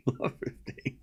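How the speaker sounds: breathless whisper through a laugh; whispered score call. Real, not acted. Calling the score while still laughing, a whisper. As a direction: let "Love fifteen" slip out as a whisper.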